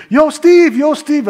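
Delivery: loud, high call across the street. Retold or imitated. Friend calling across the block, loud high shout. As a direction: shout his name from far down the block.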